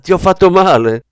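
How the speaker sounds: high, loud mock concern; smug smile. A narrator's reading. Young man hurting Kleber on purpose, smug taunting question. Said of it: "sorrise compiaciuto".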